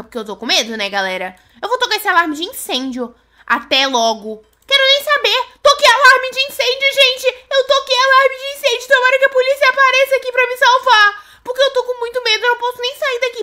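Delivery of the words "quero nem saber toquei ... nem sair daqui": high, loud, frantic chatter; agitated after pulling the alarm; panicked. Real, not acted.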